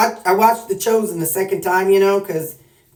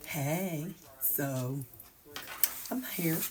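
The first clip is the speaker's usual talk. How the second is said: hushed; 14 dB quieter than usual. Real, not acted. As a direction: talk softly so work won't hear. Quiet at work filming.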